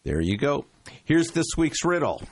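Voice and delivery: normal talking voice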